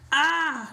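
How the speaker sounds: loud exasperated yelp; exasperated cry. Real, not acted. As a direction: throw up your hands with a cry. Exasperated reaction to court logic.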